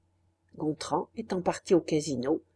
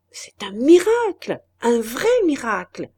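marvelling, raised and louder; excited exclamation; 3 semitones higher, 6 dB louder than usual. A narrator's reading. Banker marvelling over the patient's recovery, excited. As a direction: exclaim it like a delighted believer.

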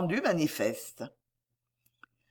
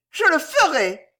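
high, tart lady's vow; reply shrilly and firmly; shrill resolve; 10 semitones higher, 6 dB louder than usual. A narrator's reading. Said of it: "elle dit d'une voix aigrelette". (réponse aigrelette et résolue)

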